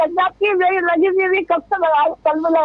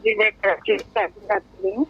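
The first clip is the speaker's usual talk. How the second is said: breaking up space radio; 5 semitones lower, 5 dB quieter than usual. Real, not acted. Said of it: "आपकी आवाज़ कट रही बहन".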